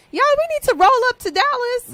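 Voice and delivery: high, loud rallying cry; excited holler. Real, not acted. Rallying everyone to go along.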